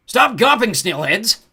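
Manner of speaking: high, loud goblin yell; scolding shout. A narrator's reading. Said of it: "Hogsquill yelled".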